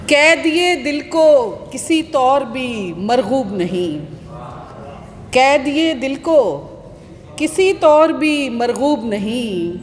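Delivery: slow, measured mushaira delivery; Urdu poetry recital. Acted. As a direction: declaim each half-line, then let it hang. Recites her couplet at mushaira.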